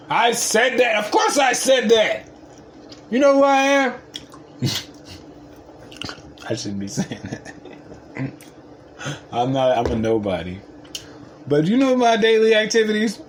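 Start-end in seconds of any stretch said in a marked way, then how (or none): 0.1-3.9 not very humble. Real, not acted. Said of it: "That was not very humble". 11.5-13.2 much higher, loud bragging question; not very humble. Real, not acted. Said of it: "That was not very humble".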